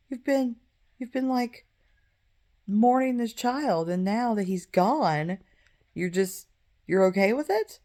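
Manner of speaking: high, rising incredulous servants' voice; servants' disbelief. Retold or imitated. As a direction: push the question up high in disbelief. Servants question David's calm.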